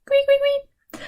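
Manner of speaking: high tweeting bird calls; teacher imitates a bird for the class; bird chirps.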